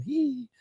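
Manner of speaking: high, drawn-out squeal; background giggle. Retold or imitated. Maria heard behind husband on phone, high squealed giggle. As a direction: imitate a high little "hee" from behind. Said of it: "you hear Maria in the back going".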